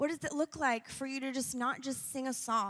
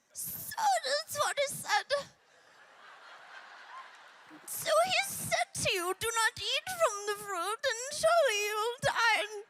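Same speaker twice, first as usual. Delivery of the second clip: much higher hissing snake voice; slow sly serpent, pitched way up; sound of Satan; 11 semitones higher than usual. Retold or imitated. Preacher doing the snake for the congregation, high and sly. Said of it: "That's the best I can do for you".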